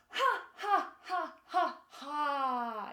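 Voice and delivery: loud, punched "ha ha" from the belly. Shown as a demonstration. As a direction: laugh mid-high, last one drawn out. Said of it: "a little bit higher".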